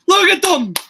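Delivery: loud, high-pitched cheer; excited shout. Real, not acted. Fan cheering on the team, excited high shout.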